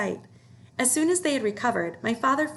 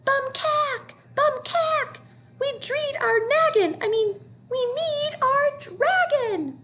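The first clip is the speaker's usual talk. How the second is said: high, squeaky tiny creature shriek; tiny excited scream; 3 dB louder, slower than usual. A narrator's reading. Tiny creature screaming after the escaping dragon, high squeaky. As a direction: screech in a tiny flustered voice. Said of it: "tiny excited voice scream".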